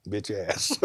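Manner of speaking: low, soft insult under the breath; hushed, low aside of contempt; unspoken thought. Retold or imitated. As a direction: slip it in quietly like a private thought. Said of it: "that was what I was thinking".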